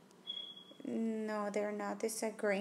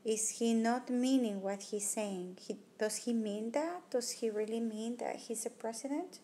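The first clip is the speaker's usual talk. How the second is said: ordinary talk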